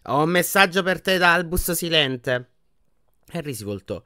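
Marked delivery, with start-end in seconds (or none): 0.1-2.5 slow, lofty, casual announcement; lazy. A narrator's reading. Portrait dropping Dumbledore's message on Harry, lazily. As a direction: toss it off unhurriedly, higher and louder. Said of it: "aggiunse pigramente".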